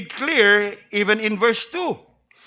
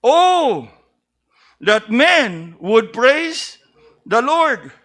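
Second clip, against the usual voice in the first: preacher stressing the Oh; emphatic Oh; 4 dB louder, much slower than usual. Shown as a demonstration. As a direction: cry the Oh, then read slowly. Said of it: "repeated five times".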